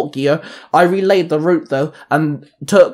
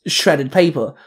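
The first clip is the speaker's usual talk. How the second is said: ordinary delivery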